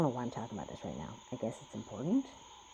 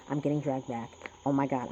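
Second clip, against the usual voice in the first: usual speaking voice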